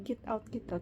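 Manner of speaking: usual speaking voice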